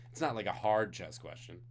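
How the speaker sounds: normal talking voice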